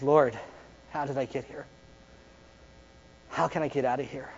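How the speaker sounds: very soft, low prayer; hushed plea. Acted. Believer on the ground asking the Lord for help, hushed low plea. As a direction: murmur it privately to God. Voices believer praying in anguish.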